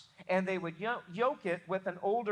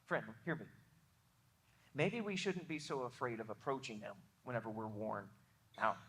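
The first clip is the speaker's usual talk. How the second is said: low, soft and close, almost confiding; a gentle, hushed plea to the worn out; intimate appeal; 9 semitones lower, 8 dB quieter than usual. Real, not acted. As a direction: lean in and speak quietly to one friend. Intimate appeal to weary listeners.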